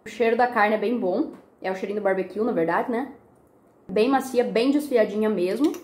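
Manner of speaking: chewing while talking; talking between bites; mouth full. Real, not acted. Seen 2: took forkful of meat at -1, mouth still working while talking.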